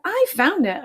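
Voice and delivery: high insistent protest; arguing. A narrator's reading. Arthur argues with Buster over the chest, high and insistent. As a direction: claim it back from your friend. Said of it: "argued Arthur".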